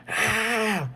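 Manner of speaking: a hint of a scream, held back; mock scream. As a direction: toss off a quick mock shriek. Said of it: "she's just going".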